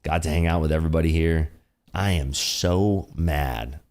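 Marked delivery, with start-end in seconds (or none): 1.9-3.8 drawn-out, slow sarcasm; deadpan mock anger. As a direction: pretend to be mad, word by slow word. Sarcastically claims to be mad.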